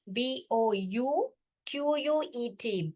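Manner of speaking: "बी ओ यू क्यू यू ई टी" letter by letter, slow and soft; slow spelling. Shown as a demonstration. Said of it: "bouquet का spelling देखिए". (अक्षर-अक्षर धीरे-धीरे वर्तनी बताते हुए)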